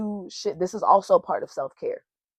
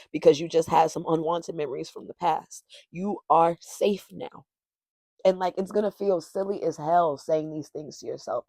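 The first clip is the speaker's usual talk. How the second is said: ordinary talk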